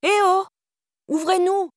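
high-pitched call, held back in volume. A narrator's reading. Girl demanding that the neighbour open up, calling out. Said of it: "cria la fille en tambourinant à la porte".

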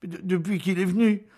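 high, loud stammer. A narrator's reading. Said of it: "Rosalie balbutia".

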